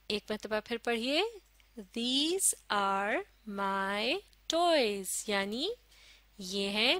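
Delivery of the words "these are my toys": very slow, a pause after each word; word-by-word model reading. Shown as a demonstration. Teacher models a sentence for learners, word by word. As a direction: space out every word for learners. Learners told to read again.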